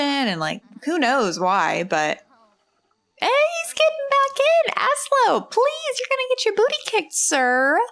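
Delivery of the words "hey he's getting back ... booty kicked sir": exasperated calling out. Real, not acted. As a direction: scold the sim in a high, squeaky exasperated voice. Calling out to misbehaving sim.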